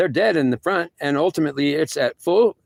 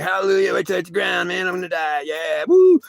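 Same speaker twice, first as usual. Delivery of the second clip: put-on higher, loud drunken whoops; drunk mock cheering; 6 semitones higher, 3 dB louder than usual. Retelling God mocking his drunk cheering, higher and whooping. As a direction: ham up a drunk man cheering his own death. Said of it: "you're waiting to hit the ground".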